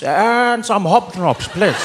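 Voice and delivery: slow, majestic statesman at the shops; stately, drawn-out grandeur; Nelson Mandela. Acted. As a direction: order biscuits like a historic speech. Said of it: "with a voice like that".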